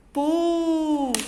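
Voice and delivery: "pu" held long; teacher isolates a drawn-out, emphatic "pu"; strong syllable. Shown as a demonstration. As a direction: sustain "pu" as the stressed syllable. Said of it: "foi o que mais saiu".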